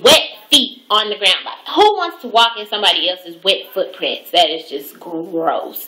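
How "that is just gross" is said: slow, low disgust. Real, not acted. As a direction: drag out the disgust. Disgusted repetition.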